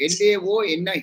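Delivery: ఎల్ ఏ ఓ ఎన్ ఐ as separate English letters L, A, O, N, I; spelled out. Shown as a demonstration. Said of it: "But La-, Leoni".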